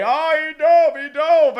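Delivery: mock whine, high and loud; mocking a player's complaint; imitating Crosby whining about a dive; whiny Crosby. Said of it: "I know Crosby's gonna say".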